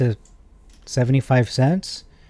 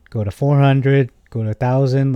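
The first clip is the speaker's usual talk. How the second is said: higher and louder, savouring each number; excited building; 4 semitones higher, 5 dB louder than usual. Real, not acted. Listing ever bigger price targets, excited.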